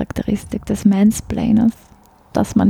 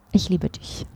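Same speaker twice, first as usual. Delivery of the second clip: „Ich liebe dich“ said much softer and sincerely, in plain Standard German; 8 dB quieter than usual. Shown as a demonstration. To the host, showing the earnest version after the joke, softly sincere. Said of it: "sage ich".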